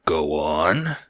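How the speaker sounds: a mob boss growls it softly; hushed threatening growl; low growling whisper. Acted. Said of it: "a rumbling growl in his throat".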